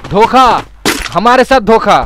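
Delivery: loud, high cry of betrayal; outraged cry. Real, not acted. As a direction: cry out as if double-crossed. Cries betrayal after being killed.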